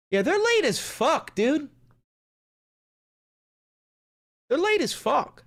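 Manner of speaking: high whiny complaint; high complaining. Real, not acted. Streamer griping that they are late. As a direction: whine about the delay.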